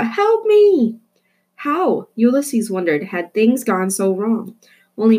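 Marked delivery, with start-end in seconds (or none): 0.0-1.0 very high, loud cry for help; panicked scream. Acted. Rita screams for help, very high and loud. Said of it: "screamed Rita".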